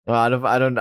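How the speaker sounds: plain reading voice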